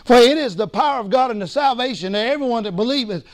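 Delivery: normal talking voice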